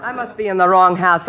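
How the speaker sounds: ordinary delivery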